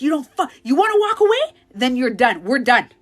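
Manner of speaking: loud, fast, high threatening yell; furious ultimatum shouted; aggressive. Retold or imitated. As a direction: threaten him as he walks out. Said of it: "I was so aggressive".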